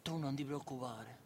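low, soft menacing reassurance; quiet sinister promise; devilish tone. Acted. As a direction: say it darkly under the breath, like a little devil. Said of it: "sembri un diavolo".

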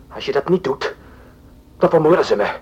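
fearful. Acted. Hostage pleads under threat.

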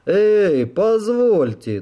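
high, drawn-out protest of a pampered official; spoiled bureaucrat. A narrator's reading. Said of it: "тоном избалованного чиновника".

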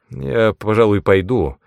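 ordinary delivery, nothing marked

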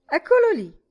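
high, loud exclamation; mocking call. Miss Deuce setting off the giggles, high loud mocking call. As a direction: announce it loudly in mockery. Barmaid mimics and mocks old man.

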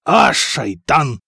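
slow, drawn-out curse; pained curse. A narrator's reading. Nadir struck in side by spear, pained curse. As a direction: drag out the curse in pain.

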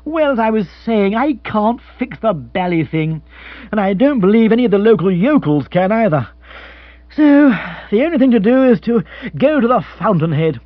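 lightly put-on dim gentleman, pitched high; lifted, aggrieved complaining voice; listless. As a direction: ramble on as an oblivious upper-class twit. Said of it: "His manner was listless".

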